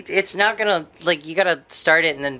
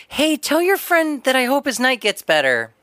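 high-pitched imitation of a kindly clerk; mock-sweet well-wishing, pitched up; sweet shop clerk; 7 semitones higher than usual. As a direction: play the concerned counter girl. Voices kind clerk's message.

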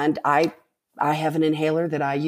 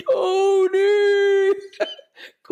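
a booming call from above, much higher and louder, laughing and teary; 12 semitones higher, 3 dB louder, much slower than usual. Retold or imitated. Brother calling down to her on the trail, booming, laughing, teary. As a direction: call her name down from the mountaintop like God himself, laughing through tears. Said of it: "this voice coming from above saying".

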